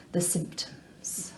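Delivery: slow, hushed reveal; hushed dread. Acted. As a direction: drop low in volume and stretch it out ominously. Ominous news of flatmate's illness.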